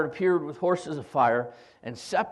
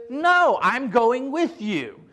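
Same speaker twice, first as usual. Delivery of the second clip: slow, firm and a bit louder; stubborn slow refusal; 6 semitones higher, 4 dB louder, much slower than usual. Retold or imitated. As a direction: drag each word out, unbudging. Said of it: "It was like cavemen almost".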